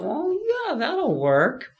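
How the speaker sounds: very high, light offhand agreement; mock casual thought. Runner retelling his thought about the getaway car, high and offhand. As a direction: squeak it out like it's no big deal. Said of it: "and I thought".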